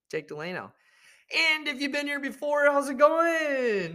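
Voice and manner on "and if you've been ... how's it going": high, loud, stretched-out hello; hyped greeting. Real, not acted. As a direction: greet the regulars like a big hype host. Greeting returning listeners.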